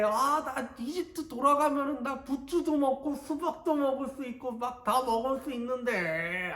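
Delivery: high, drawn-out whining about the manna; whiny complaining Israelites. Acted. Preacher voicing Israelites grumbling in the wilderness, whiny and high. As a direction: whine like a bored child sick of the desert. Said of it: "하면서, 이스라엘 사람들이 그렇게 불평도 했었어요".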